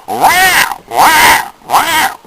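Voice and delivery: very high, loud trumpeting wail; elephant moaning. Retold or imitated. Imitating the corked elephant's loud, high-pitched moan. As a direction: bellow it out like a swollen elephant. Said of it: "you know that sound the elephants make?".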